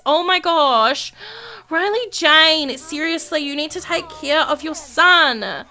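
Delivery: ordinary talk, nothing marked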